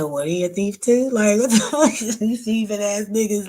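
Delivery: high, loud disbelief with a laugh; incredulous outrage. Retold or imitated. Retelling the thief story, laughing incredulous outrage. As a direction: throw up your hands in laughing disbelief.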